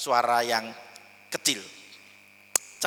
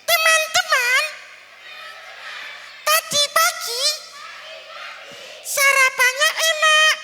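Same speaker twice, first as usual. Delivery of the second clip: put-on small child voice; 4 dB louder, slower than usual. Shown as a demonstration. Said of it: "Yuk ulangi".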